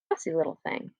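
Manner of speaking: soft unvoiced mutter at the computer; muttered aside. Real, not acted. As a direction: grumble under your breath.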